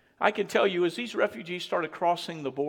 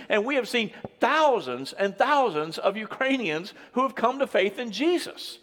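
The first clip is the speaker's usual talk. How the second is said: raised, building sermon delivery; fervent preaching; 5 semitones higher, 4 dB louder than usual. Real, not acted. Preacher praising churches that took in refugees, fervent preaching. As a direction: testify with mounting force to the congregation.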